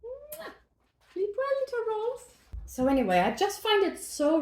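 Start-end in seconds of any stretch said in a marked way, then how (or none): 0.0-0.5 quick high soft smooch; kiss sound. Real, not acted. Kisses the rolls into the oven. 1.1-2.2 tender goodnight. Real, not acted. Bids the rolls goodnight.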